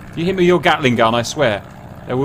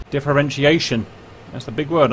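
ordinary delivery

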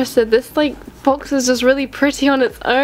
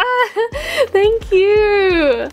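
teary thanks; 7 semitones higher, much slower than usual. Real, not acted. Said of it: "I'm gonna cry".

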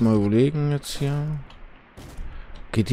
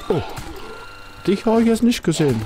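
startled; 10 semitones higher, 3 dB louder than usual. Real, not acted. Startled by enemy in game.

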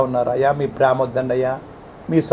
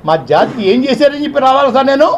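angry outburst; 11 semitones higher than usual. Real, not acted. Community leader demanding answers from Jagan, shouting in anger. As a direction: shout the questions in real outrage.

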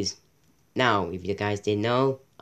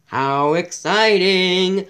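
sarcastic excitement; 6 semitones higher, 5 dB louder, much slower than usual. Acted. Sarcastic reading of caption.

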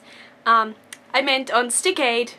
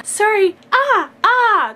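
ordinary delivery

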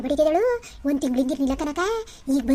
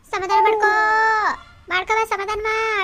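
higher, building to a shout; loud staged consoling; 5 semitones higher, 4 dB louder than usual. Pretend. Aunt consoling the crier so neighbours hear, loud staged consoling. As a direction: console at full volume for show. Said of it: "ಜೋರ್ ಜೋರಾಗಿ ಜೋರಾಗಳು ಇನ್ನುವೆ".

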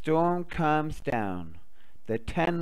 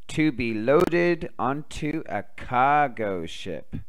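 usual speaking voice

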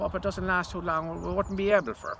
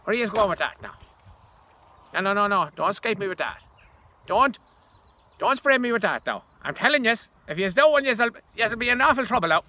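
louder, higher, pleading warning; loud protest; 3 semitones higher, 9 dB louder than usual. Acted. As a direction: warn them off the spray, loudly. Threatened with the spray bottle.

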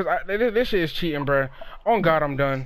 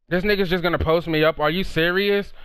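ordinary talk, nothing marked

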